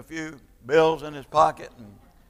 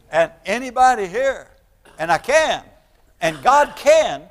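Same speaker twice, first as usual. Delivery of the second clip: louder and higher, a preacher warming to his point; fervent preaching; 8 semitones higher, 10 dB louder than usual. Acted. Pastor pressing the congregation on God's love, fervent preaching. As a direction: build the sermon with rising conviction.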